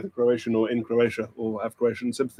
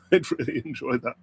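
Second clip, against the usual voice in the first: ordinary talk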